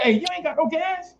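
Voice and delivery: high, amazed disbelief; incredulous. Retold or imitated. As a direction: pitch up in shock at her. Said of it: "I'm like".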